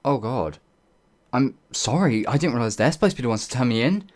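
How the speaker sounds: loud, fast flustered gush of apology; horrified outburst, words tumbling fast; panicked apology. Acted. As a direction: blurt it all out in a rush of panic. Realizes she turned herself in wrong.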